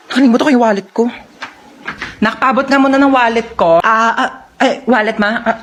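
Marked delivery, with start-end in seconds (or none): none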